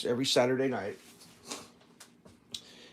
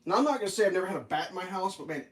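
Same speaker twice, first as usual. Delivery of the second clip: normal talking voice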